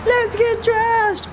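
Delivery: high, slow mock fancy voice; mock posh voice. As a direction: put on a silly prim voice. Imitating people going out.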